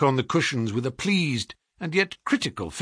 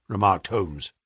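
plain reading voice

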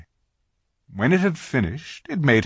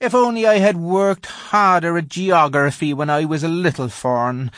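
melancholy; 9 semitones higher, 5 dB louder than usual. A narrator's reading. Faun regrets his geography to Lucy, melancholy. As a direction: sigh it out as a regretful little faun. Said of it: "in a rather melancholy voice".

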